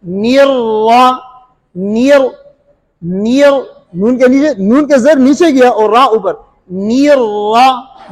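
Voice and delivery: very slow नियर with a thin nun and a full ra; slow recitation demo; correct niyar. Shown as a demonstration. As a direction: stretch नियर रा carefully. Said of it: "इसी तरह नियर".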